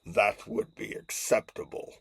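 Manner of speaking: stiff, deliberate villain voice; formal and measured, half-voiced menace; Darth Vader. Vader agrees to a rom-com, stiff and formal. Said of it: "And he says".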